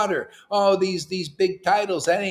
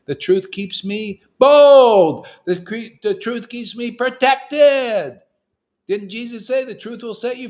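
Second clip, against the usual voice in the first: bold preaching; 8 semitones higher, 8 dB louder than usual. Acted. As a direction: proclaim it like a battle cry. Preaching boldly about truth.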